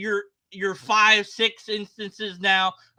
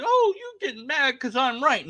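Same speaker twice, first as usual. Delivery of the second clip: mocking opponent; 9 semitones higher than usual. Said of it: "And if he said".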